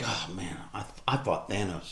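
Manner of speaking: low, very soft grumble to oneself; imitated weary resignation. Hero who fought Thanos faces a silly battle, quietly resigned. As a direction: play a dejected hero, voice sunk low.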